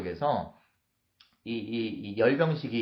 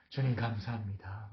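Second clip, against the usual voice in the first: soft, secretive acted prayer; inward thanks; 3 semitones lower, 7 dB quieter than usual. David secretly thanking the Lord, inward thanks. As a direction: breathe thanks under your breath. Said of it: "속으로 뭐라고 했을까요?".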